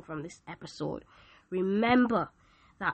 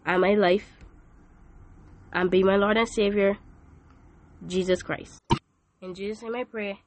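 leading prayer; 2 semitones lower, 6 dB louder than usual. Acted. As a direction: lead them line by line in prayer. Leads the sinner's prayer.

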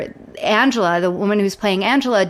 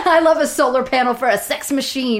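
laughing; 3 semitones higher than usual. Real, not acted. Laughing while joking.

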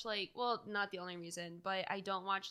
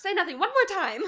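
high, loud, laughing tease; laughing mock plea; 10 semitones higher, 14 dB louder than usual. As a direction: egg it on through laughter. Mocking the repeated line.

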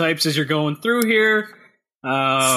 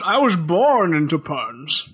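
put-on a seventy-year-old Bane, slow and solemn; much slower than usual. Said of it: "That's a great old man voice you got there".

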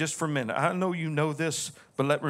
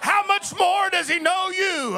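rising, shouted preacher's cadence; full preaching; 10 semitones higher, 4 dB louder than usual. Acted. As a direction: preach it higher and louder, building to a climax. Pastor preaching at full force.

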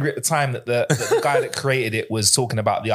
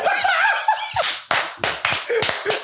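sudden shrieking burst of laughter. Real, not acted. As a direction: crack up in a high laugh.

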